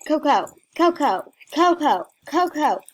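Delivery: loud, rapid repeated name; calling out. Real, not acted. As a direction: pester someone by calling the name quickly. Calling out to pester a player.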